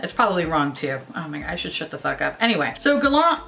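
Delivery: usual speaking voice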